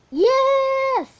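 high, loud triumphant yes; victory cheer. Real, not acted. Kid gamer cheering the final kill, loud and high. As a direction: shout the win.

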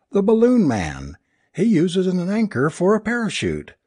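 high-pitched, anguished mock fanfare; bitter cry. A narrator's reading. Alice mocks her husband's stage plan, crying bitterly. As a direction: cry it out like a scornful showbill announcer. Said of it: "crying bitterly".

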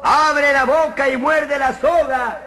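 agudo, lento y fuerte; voz de personaje. Acted. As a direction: gritarle al de abajo desde arriba. Personaje de arriba. (el de arriba grita hacia abajo, lento y agudo)

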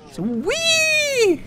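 a high, long-held "whee"; gleeful whee. Pretend. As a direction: sing it out as the dog slides down. Dog on slide. (højt, langt udstrakt "whee")

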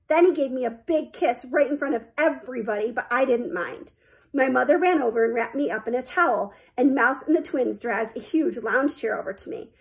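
plain reading voice

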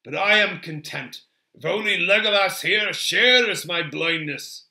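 lively, jovial scoffing without laughing; amused Gimli. A narrator's reading. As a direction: tease with boisterous dwarvish amusement. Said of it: "Gimli laughed suddenly".

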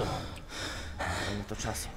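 slow low murmur. Shown as a demonstration. Gamer repeating his line to himself as a slow low murmur. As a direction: drag it out soft and low like an ominous aside.

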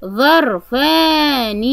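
ظرفان stretched slowly with a heavy ظ; slow model word. Shown as a demonstration. Teacher modelling ظرفان slowly for pupils. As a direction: say ظرفان slowly, holding each sound. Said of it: "أنطق".

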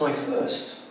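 "I thirst" breathed out as a soft, unvoiced whisper; faint dying plea. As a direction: let "I thirst" fade to a parched whisper. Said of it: "Jesus says".

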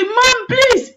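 high, urgent plea; child pleading. Retold or imitated. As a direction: beg mother like a desperate child. Child begging mother not to pack.

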